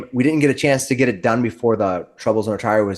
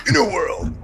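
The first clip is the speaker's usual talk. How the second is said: movie trailer announcer; 6 semitones higher than usual. Shown as a demonstration. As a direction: say it like a film trailer opens. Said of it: "I gotta get my an-, announcer voice in".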